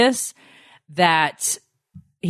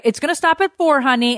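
mock husband; 8 semitones higher than usual. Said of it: "Corey's like".

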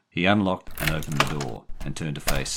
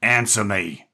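loud, raised-pitch order; angry demand; 6 semitones higher, 6 dB louder, slower than usual. A narrator's reading. Jerry demanding an answer from the boy, angry. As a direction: demand it angrily. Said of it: "face blotched red with anger".

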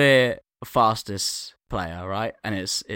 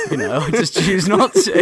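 laughing brag; 5 semitones higher, 6 dB louder than usual. Real, not acted. Mock boast.

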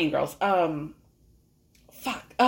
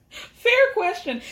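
words squeezed out through a laugh, slow; cracking up mid-line; laughing helplessly at the joke; 12 semitones higher, 3 dB louder, much slower than usual. Real, not acted. Host cracks up at quote.

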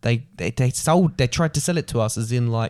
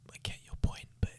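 much softer, whispered under the breath; whispered aside; 14 dB quieter than usual. Real, not acted. Murmuring an aside to his co-host, whispered.

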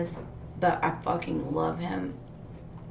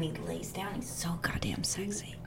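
whispered, much softer; breathy whisper; 10 dB quieter than usual. Real, not acted. To friends gushing about her crush, a soft whisper. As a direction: hush it like a guilty confession. Gushing over Oscar Isaac.

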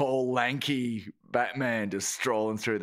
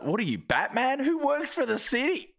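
high, mock-baffled comeback; incredulous; 11 semitones higher than usual. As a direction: pitch climbing in disbelief. Acted incredulous comeback to audience member.

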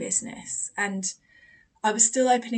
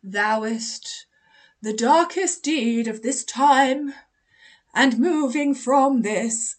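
slow, loud theatrical declaiming; mock Shakespearean; 3 semitones higher, 7 dB louder, much slower than usual. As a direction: intone it like a hammed-up Shakespeare play. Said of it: "they're Shakespeare? They're part of a Shakespeare play".

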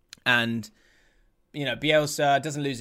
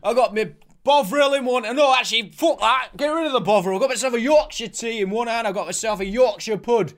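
loud, higher hammed-up Yorkshire lad; Yorkshire accent; 7 semitones higher, 7 dB louder than usual. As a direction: boast like a loud Yorkshire fan at the match. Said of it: "Proper Yorkshire lads".

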